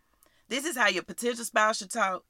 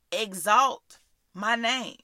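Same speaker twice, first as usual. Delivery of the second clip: lightly voiced God, slow and deliberate; slow, measured divine command; the Lord speaking; slower than usual. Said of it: "I believe the Lord is saying".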